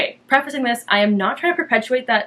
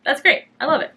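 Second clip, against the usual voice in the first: much higher, bright and eager; delighted exclamation; chirpy praise for a fun mini spin; gushing; 8 semitones higher than usual. Retold or imitated. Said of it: "I was like".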